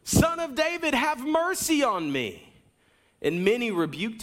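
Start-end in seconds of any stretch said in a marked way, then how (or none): 0.0-2.5 higher pitched cry for mercy, last words slower; shouting out. A narrator's reading. Blind beggar crying out to passing Jesus, pleading shout. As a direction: call out desperately across a crowd. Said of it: "he began to shout".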